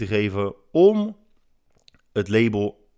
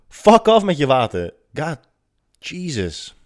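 loud, higher fed-up retort; exasperated outburst; 6 semitones higher, 6 dB louder, slower than usual. Real, not acted.

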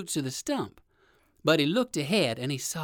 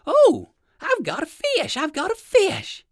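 high, loud whoop at a catch; thrilled storybook rabbit shouting; excited cry; 13 semitones higher, 7 dB louder than usual. A narrator's reading. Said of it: "he cried as he lifted up the pole".

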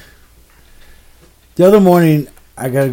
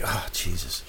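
low, quiet, fed-up mutter under the breath; low weary exasperation; exasperated groan; 12 semitones lower, 14 dB quieter than usual. Retold or imitated. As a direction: sigh out the disbelief. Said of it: "So I'm like".

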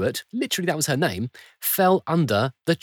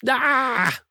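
high, sudden cry of frustration; a sharp, high exasperated "Ah!". Real, not acted. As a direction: let out a strangled yelp of despair. Frustrated outburst after listing problems.

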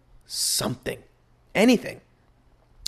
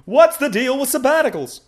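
high, nasal-free standup observational lilt, much higher; Jerry Seinfeld; 11 semitones higher, 5 dB louder than usual. Retold or imitated. Comic bit asking about sabbaticals, high standup voice. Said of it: "Jerry Seinfeld, what are you doing here?".

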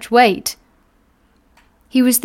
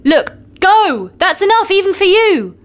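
exasperated shout; 9 semitones higher than usual. Acted. Carrier shouting at giant.

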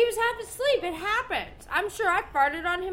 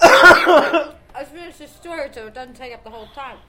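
loud laughing protest; 15 dB louder than usual. Real, not acted. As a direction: laugh while insisting. Laughing over interruptions.

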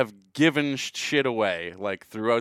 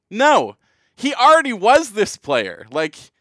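higher and louder, pushing the point; heated rebuttal; 8 semitones higher, 7 dB louder, slower than usual. Real, not acted. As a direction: argue it hot, stressing already. Arguing back at fans.

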